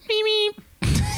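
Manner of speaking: tiny squeaky car horn imitation; high-pitched horn. Shown as a demonstration. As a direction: squeak like a half-broken horn. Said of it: "really high pitched".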